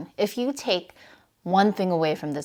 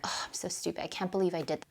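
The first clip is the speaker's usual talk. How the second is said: unvoiced, much softer self-scolding mutter; muttered under breath; 8 dB quieter, much faster than usual. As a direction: mutter it to yourself, barely audible. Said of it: "I muttered it under my breath".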